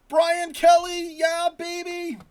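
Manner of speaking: loud, high, drawn-out hype yell; excited shout. Real, not acted. Streamer hails a viewer in the chat, excited shout. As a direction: belt out the name like a roaring fan.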